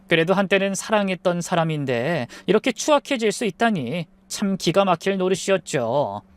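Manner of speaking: ordinary delivery, nothing marked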